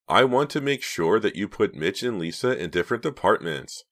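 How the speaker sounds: ordinary delivery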